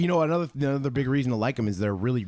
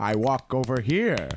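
radio drama parody; slower than usual. Acted. Parodies radio drama walking scene.

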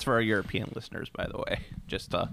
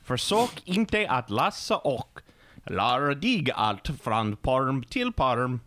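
hammed-up Swedish accent; much slower than usual. Shown as a demonstration. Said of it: "mock Swedish reading".

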